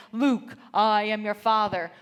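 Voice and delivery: loud, slow, booming Darth Vader. Retold or imitated. Husband duels his son with lightsabers, booming Darth Vader. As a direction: play the movie villain in a lightsaber fight, drawn out and loud. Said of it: "my husband says".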